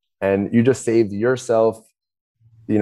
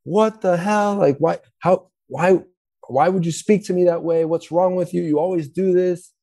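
much higher, indignant mock outrage; triggered outburst; 9 semitones higher than usual. Partner at home triggered by the dinner complaint, indignant. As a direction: fire it back like you've been attacked. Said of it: "get triggered about it and be like".

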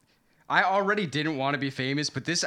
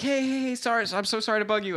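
eager fan voice; 7 semitones higher than usual. Retold or imitated. Fan stopping him on street.

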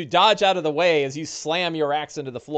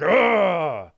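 drawn-out roar, raised in pitch and volume; battle roar; 5 semitones higher, 5 dB louder than usual. Acted. Orc grabs his axe with both hands, roaring. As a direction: roar while swinging an axe. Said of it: "roars as you're like".

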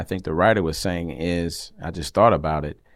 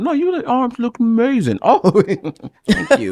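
high, exaggerated flattery; mock gushing; 13 semitones higher than usual. Acting out someone fishing for compliments, mock-flattered. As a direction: gush it way up high, hammed-up.

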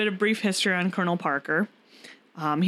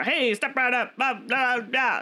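lightly voiced carnival barker hawking; a hint of a fairground barker calling the crowd in; 4 semitones higher, slower than usual. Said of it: "Yes, a carnival barker".

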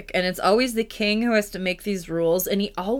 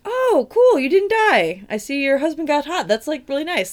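awkward king; 8 semitones higher, 4 dB louder than usual. Acted. King bluffs casually to daughter.

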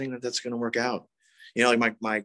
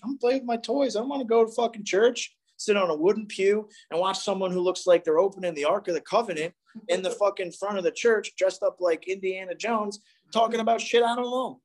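put-on higher, whining like a bored kid dragged to church; whiny kid; 7 semitones higher than usual. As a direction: play the sulky seven-year-old, pitched up. Child whining about going to church.

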